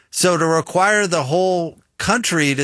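ordinary delivery

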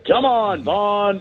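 high barked coach call; barking gym coach. Retold or imitated. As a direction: yell it like the Major League coach. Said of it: "he sounded like the coach from Major League".

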